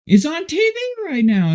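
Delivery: very high-pitched imitation of an excited wife; imitated high, thrilled announcement; excited exclaiming. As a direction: squeal the news in a high voice. Voicing wife seeing them on TV.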